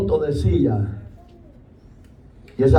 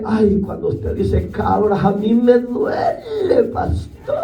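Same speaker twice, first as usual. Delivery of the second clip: mock hurt whine; 6 semitones higher, slower than usual. Mimicking an offended church member.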